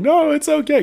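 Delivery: very high, loud acted-out reassurance; mock consoling. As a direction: cry out hammed-up comfort. Consoling a crying streamer in retelling.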